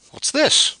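high, loud demand. Acted. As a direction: snap out a demand. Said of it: "demanded Carr".

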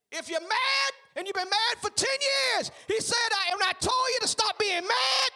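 high, loud, shouted church testimony; preaching at full force. Acted. Pastor rousing the church, shouting his testimony. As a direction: call it out, rousing the congregation. Shouted sermon climax, God's voice quoted.

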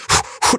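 quick unvoiced puffs of breath; acted-out running breaths; imitated huffing. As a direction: puff like someone jogging. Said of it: "I'm, like, kinda like".

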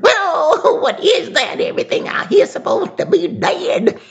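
put-on very high, loud old lady; high old woman voice. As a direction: squeal it like a spooked granny.

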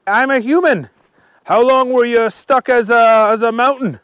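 high, loud character voice; curious Archie. A narrator's reading. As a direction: ask eagerly, pitched high.

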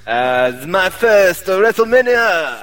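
half-joking ring announcer call, slow. Acted. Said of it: "I don't know why I'm calling this voice".

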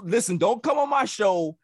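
normal talking voice

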